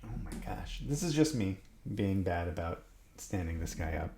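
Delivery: quiet. Real, not acted. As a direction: hush down to keep a sleeper asleep. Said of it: "my wife is asleep in the other room".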